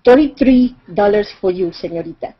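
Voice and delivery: put-on thick Spanish accent, loud start dropping away. Seller insisting on his price to haggling tourist, thick Spanish accent. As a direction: repeat it firmly in a heavy Mexican Spanish accent. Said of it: "again in a very thick accent".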